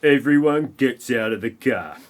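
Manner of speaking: ordinary talk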